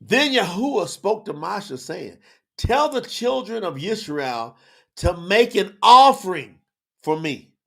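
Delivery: high, slow, declaimed verse; ringing, measured scripture proclamation; preached scripture reading. Real, not acted. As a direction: proclaim each phrase with weight, swelling on the command. Reads scripture aloud.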